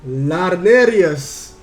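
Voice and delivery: loud, drawn-out name; big, long-held shout-out; calling out a greeting. Real, not acted. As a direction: hail the newcomer loudly, stretching the name. Greets someone newly arrived in stream.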